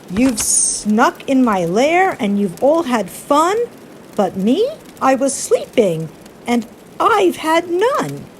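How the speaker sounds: loud, high aggrieved bear; upset bear. Acted. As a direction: wail it like a grumpy woken bear. Woken bear complains.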